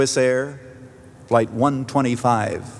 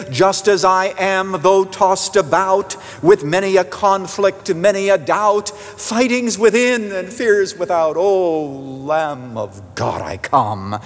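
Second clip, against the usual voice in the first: slow, higher-pitched verse, falling at the close; hymn recitation; 6 semitones higher, slower than usual. Acted. Preacher quotes a hymn to the congregation, slow heartfelt recitation. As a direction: stretch the lines out, coming down to rest at the end.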